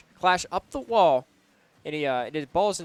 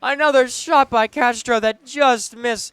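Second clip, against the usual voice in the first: excited; 8 semitones higher, 9 dB louder, slower than usual. Real, not acted. Near goal hits crossbar.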